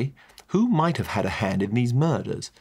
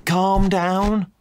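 higher and louder hammed-up soothing; mock soothing; 11 semitones higher, 5 dB louder than usual. Narrator cooing at a weeping woman to calm her. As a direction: flap about and coo. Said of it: "cooing at her to calm down".

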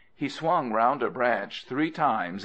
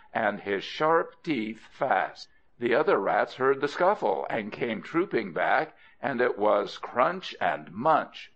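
ordinary delivery, nothing marked

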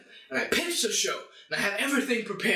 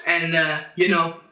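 loud; 3 semitones lower, 8 dB louder than usual. Real, not acted. Loud outro.

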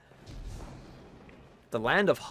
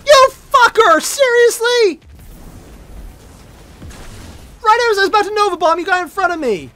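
angry shout; 11 dB louder than usual. Real, not acted. Teammate blocked his Nova Bomb.

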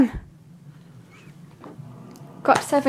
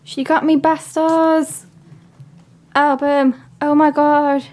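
higher-pitched, thrilled disbelief; real excitement; 4 semitones higher than usual. Real, not acted. Gift opener sees the album mum got, excited. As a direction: let the thrill lift the voice, drawing words out.

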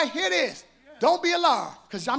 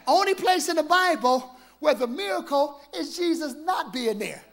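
ordinary talk

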